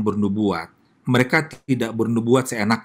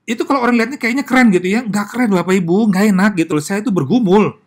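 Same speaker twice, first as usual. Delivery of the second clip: higher, louder, fast and insistent; emphatic protest; 8 semitones higher, 4 dB louder than usual. Real, not acted. Pastor telling the congregation prophecy is no fun, heated and high. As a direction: push back hard, nothing glamorous here.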